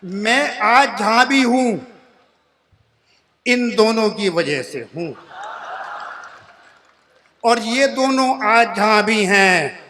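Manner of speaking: usual speaking voice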